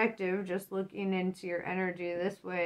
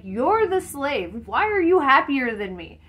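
angry slave master; 6 semitones higher, 9 dB louder than usual. Retold or imitated. Master yelling at his slave, loud indignant shout. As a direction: bellow it like a baffled master. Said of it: "He's like".

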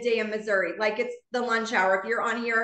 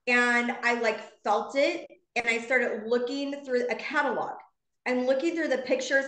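normal talking voice

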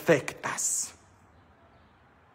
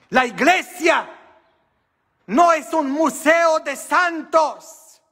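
raised preaching voice, ringing out each phrase; preaching hard; 9 semitones higher than usual. Acted. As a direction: proclaim it to the whole church. Preaching to the congregation.

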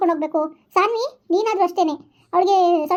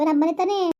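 ordinary talk, nothing marked

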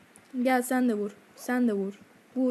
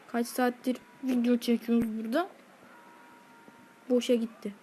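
normal talking voice

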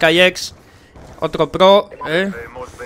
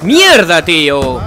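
loud curse, pitch falling away; loud, exasperated gamer curse; real frustrated outburst; 6 dB louder, much slower than usual. Real, not acted. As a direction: burst out swearing at the game. Swears at losing in game.

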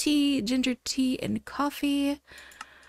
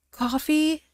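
rising, amazed Coffee? asked in disbelief; real delighted surprise; surprised question; 4 semitones higher than usual. Real, not acted. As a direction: ask Coffee? as if you can't believe it.

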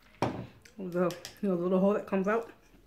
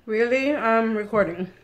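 loud, higher, irritated; annoyed scold; 3 semitones higher, 10 dB louder, slower than usual. Real, not acted. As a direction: snap at the interruption. Scolding interrupter while filming.

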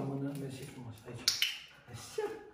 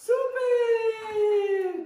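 a high, loud cry of joy; joyful shout; 8 dB louder than usual. Real, not acted. Trainer cheering the dog's breakthrough, a loud high joyful shout. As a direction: yell it out in real delight.

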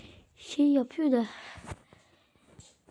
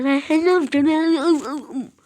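slow taunt; much slower than usual. Real, not acted. Mocking enemy in game.

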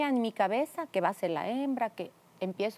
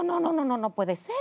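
retold disbelief; 5 semitones higher, 5 dB louder than usual. Retells disbelief at pregnancy.

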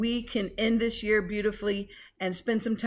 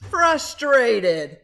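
frustrated; 8 dB louder than usual. Acted. Reading frustrated page.

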